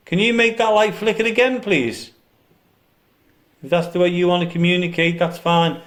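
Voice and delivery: loud coaxing call to spirits. Real, not acted. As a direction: coax the spirit out loudly.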